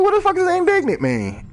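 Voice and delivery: baffled. Retold or imitated. Stuck on a word in a book, high incredulous. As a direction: throw your voice way up in disbelief. Puzzled by a word while reading.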